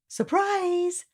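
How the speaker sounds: high, sung-out surprise; mama revealing the sister; cheerful surprise. Acted. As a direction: call it out to delight the girl. Mama announcing.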